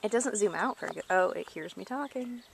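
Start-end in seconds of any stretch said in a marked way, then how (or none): none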